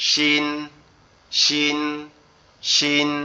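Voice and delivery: শিন with the middle of the tongue on the palate; repeated letter demo. Shown as a demonstration. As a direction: drill শিন, cheek teeth pressed. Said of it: "দেখে বলতে হবে".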